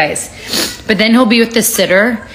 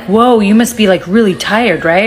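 usual speaking voice

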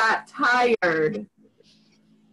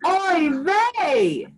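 practice call; 7 semitones higher than usual. Shown as a demonstration. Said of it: "let's practice right now".

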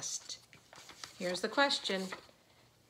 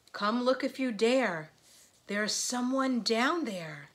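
higher, lilting picture-book voice; read-aloud to children; 3 semitones higher, 5 dB louder than usual. A narrator's reading. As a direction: read the rhyme out with lift for little listeners.

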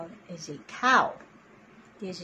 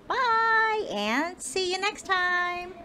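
cheery goodbye; 11 semitones higher, slower than usual. Real, not acted. Cheerful sign-off to child.

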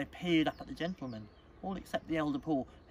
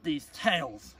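loud, slow exasperation ending on "tales"; disgusted; 3 semitones higher, 7 dB louder, slower than usual. Acted. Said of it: "He spat out the word tales with particular disgust".

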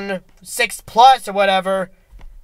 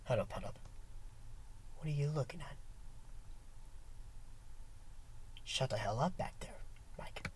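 whisper; 8 semitones lower, 24 dB quieter, faster than usual. Real, not acted. Whispering at someone behind camera.

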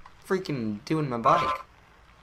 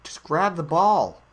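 louder, insistent push to grab it; urgent call; 4 semitones higher, 7 dB louder than usual. Real, not acted. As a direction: urge it on loudly. Urging the ball into place.

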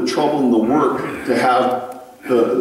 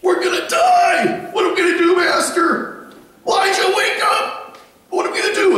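very high, hammed-up panicked shriek; squealing, over-the-top terrified cry; mock panic. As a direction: wail it like a servant frantic for his master's help. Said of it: "and he's in a panic".

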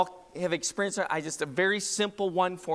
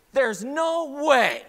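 high, loud, drawn-out mock disbelief; acted disbelief; 10 semitones higher, 8 dB louder, much slower than usual. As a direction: protest in exaggerated disbelief, stretching it out. Acting out disbelief at bathroom scale.

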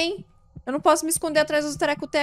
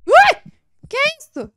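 high shriek then a shocked question; startled yelp; 12 semitones higher, 10 dB louder than usual. Real, not acted. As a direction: jump out of your skin. Sudden scare in horror game.

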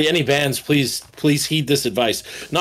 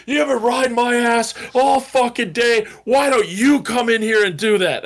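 higher, imitated blow-up at the producer; mock angry outburst; 8 semitones higher than usual. Exhausted drummer snapping at producer through the glass, mock angry. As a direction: snap back like a fed-up drummer, retold. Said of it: "he just beaks off".

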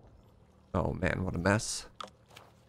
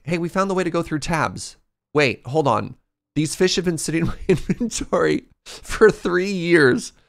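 ordinary talk

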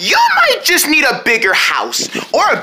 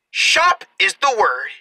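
normal talking voice

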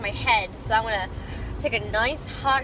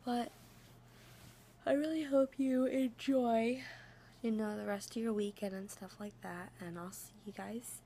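hushed late-night whisper; 2 semitones lower, 16 dB quieter than usual. Real, not acted. Late-night outro, kept hushed.

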